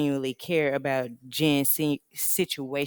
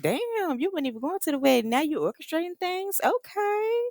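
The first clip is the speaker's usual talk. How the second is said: high, sassy mock voice of the girls; mocking the group; 11 semitones higher than usual. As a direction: squeal it out like catty friends. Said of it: "And everybody like".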